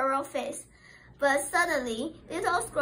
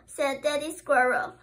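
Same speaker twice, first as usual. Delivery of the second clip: usual speaking voice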